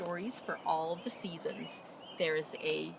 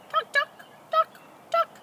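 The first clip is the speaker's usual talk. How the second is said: much higher, louder hen clucks; clucking hen; 10 dB louder than usual. Acted. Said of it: "she clucked".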